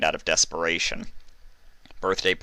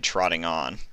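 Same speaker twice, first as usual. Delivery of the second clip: plain reading voice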